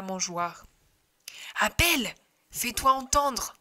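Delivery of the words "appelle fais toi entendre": eager coaxing push; urgent urging. Acted. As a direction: prod him to speak up. Shepherds urge a call.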